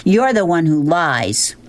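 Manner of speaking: mild acted shout, pitched high; mock shout. A narrator's reading. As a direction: throw the blame back in a restrained yell. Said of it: "she shouted from the back seat".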